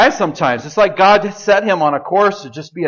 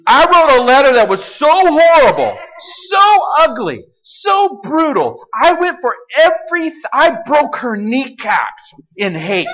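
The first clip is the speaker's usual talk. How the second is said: impassioned outburst; 7 semitones higher, 5 dB louder than usual. Real, not acted. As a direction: pour out the shame loudly. Confessing his hateful letter.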